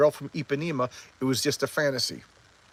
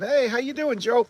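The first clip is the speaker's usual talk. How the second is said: higher-pitched, mock-friendly hello; cheery greeting; 10 semitones higher than usual. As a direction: act out an upbeat greeting.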